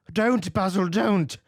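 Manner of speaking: high, loud desperate cry; cried out. A narrator's reading. Dorian crying out to stop the painter destroying it, desperate. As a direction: stop him at all costs. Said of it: "he cried".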